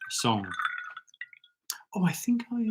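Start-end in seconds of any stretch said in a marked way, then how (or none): 1.9-2.6 whispering. Real, not acted. Aside during quiz.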